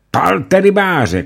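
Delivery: very high, loud, slow shout. Acted. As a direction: scream it in rage. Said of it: "křičel Ulenšpígl".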